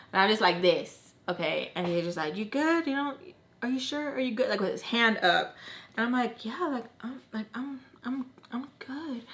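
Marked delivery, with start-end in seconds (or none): 2.3-4.5 soft, higher-pitched checking in; imitating close-up talk. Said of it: "is talking to me like this". 6.4-9.2 halting, nearly voiceless reply; flustered whisper. Retold or imitated. Flustered reply to Joe leaning close.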